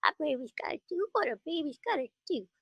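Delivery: put-on high little baby voice; cartoon baby voice; Tommy Pickles voice. Shown as a demonstration. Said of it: "Tommy Pickles, who's like".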